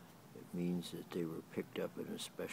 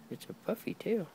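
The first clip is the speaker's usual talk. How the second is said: much higher, sing-song praise of the tail; high cooing dog talk; 13 semitones higher than usual. Real, not acted. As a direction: coo it up high like praising a puppy.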